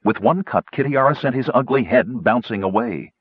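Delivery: ordinary talk, nothing marked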